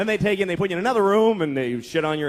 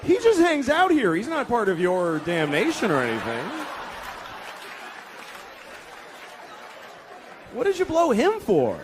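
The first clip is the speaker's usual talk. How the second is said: mock boss of hell; exasperated boss; 4 semitones higher than usual. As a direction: lecture him in disbelief. Hell boss scolding narrator.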